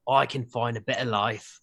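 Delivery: Cockney attempt that lands in Australia; Australian accent. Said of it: "you're, you're Australian".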